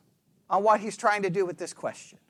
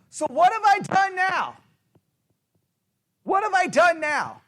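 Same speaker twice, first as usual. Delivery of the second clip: higher, exasperated complaint; frustrated; 7 semitones higher than usual. Acted. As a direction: throw up the question in exasperation. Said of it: "in a frustrated way".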